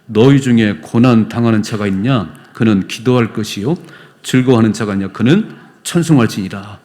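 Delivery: loud unison Bible reading; congregational scripture reading. Real, not acted. Pastor leading church in reading James, loud. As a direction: read James aloud together, firm. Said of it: "같이 읽어봐요".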